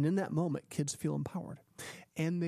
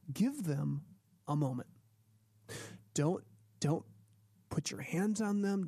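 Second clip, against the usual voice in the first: normal talking voice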